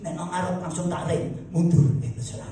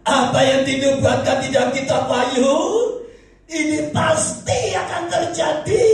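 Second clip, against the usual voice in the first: full-force preaching; 9 semitones higher, 6 dB louder than usual. Acted. Preacher warning congregation of a great earthquake, proclaiming loudly.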